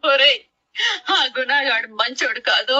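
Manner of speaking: crying while warning her son; weeping reproach; tearful scolding. Acted. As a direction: scold through sobs. Mother breaks down scolding her son.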